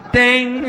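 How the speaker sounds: high shouted bell ding. Retold or imitated. Comic imitates the boxing bell, loud high ding. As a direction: ring it out like a fight bell. Bell sound after knockout.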